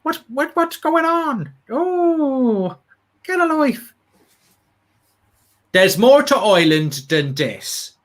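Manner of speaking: high-pitched hammed-up Irish caricature; Irish accent. Shown as a demonstration. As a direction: squeal it in a comic stage-Irish accent. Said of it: "Mark, do an Irish accent".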